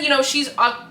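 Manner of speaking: ordinary talk, nothing marked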